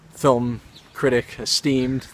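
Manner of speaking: ordinary delivery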